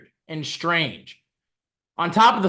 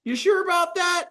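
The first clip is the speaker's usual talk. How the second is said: much higher, sarcastic doubting questions; mock disbelief; 13 semitones higher, 3 dB louder than usual. Doubting the GM's stealth explanation, in high sarcastic disbelief. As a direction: pitch it way up and sarcastic.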